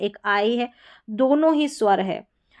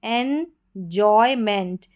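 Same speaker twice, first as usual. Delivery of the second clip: Enjoyment drawn out, every syllable clear; slow model word; slower than usual. Shown as a demonstration. Teacher models the word for students, slowly. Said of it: "तो enjoyment".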